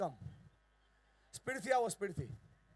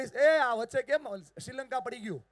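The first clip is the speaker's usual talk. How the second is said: raised, loud mock protest; teasing call-out; 8 semitones higher, 8 dB louder, slower than usual. Real, not acted. Speaker ribs the crowd about a gap, teasing call-out. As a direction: call out the slackers playfully.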